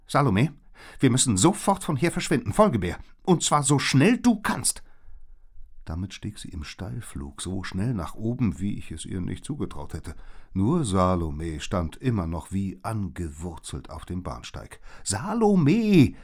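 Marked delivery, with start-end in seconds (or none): 0.1-4.8 high, loud, hurried order; urgent call. A narrator's reading. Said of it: "Doch dann rief sie". 15.0-16.1 long, high, loud name call; gerufen. A narrator's reading. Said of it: "rief Liz".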